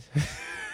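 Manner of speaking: tiny high giggle. Real, not acted. Giggles at listener names.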